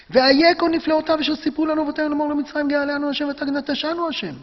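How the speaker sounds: high-pitched frustrated protest, acted out; aggrieved complaint. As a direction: play an angry man demanding answers. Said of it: "הוא מדבר בטרוניה, הוא מדבר בכעס, הוא מדבר בתסכול". (טרוניה כועסת ומתוסכלת)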